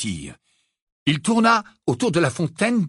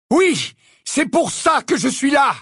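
shout; 10 semitones higher, 3 dB louder than usual. A narrator's reading. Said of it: "hurla Harry".

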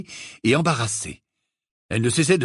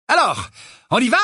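cheerful; 10 semitones higher, 5 dB louder than usual. Acted. Father eager to go.

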